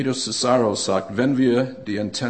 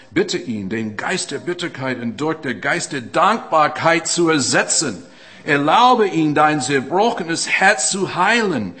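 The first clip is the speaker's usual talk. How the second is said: raised, ringing pulpit voice, pressing forward; fervent preaching; 3 semitones higher, 7 dB louder than usual. Acted. Preacher urging the congregation toward change, in a raised preaching voice. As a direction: preach it out to the whole room.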